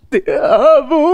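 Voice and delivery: "te amo" larguísimo y tembloroso, en broma. Pretend. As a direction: alarga la frase con voz temblorina, más aguda. (voz temblorosa y exagerada)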